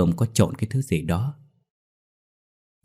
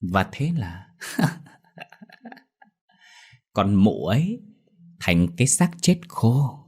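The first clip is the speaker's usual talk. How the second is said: slow laughing taunt; gloating laugh; slower than usual. A narrator's reading. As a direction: savor each word with malice. Woman gloats about the death.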